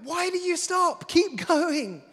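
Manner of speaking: high, loud cheering call to keep pedalling; encouraging call. Retold or imitated. Dad calling out to daughter on her bike, encouraging. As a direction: urge her on, bright and loud. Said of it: "when you hear the tone in my voice".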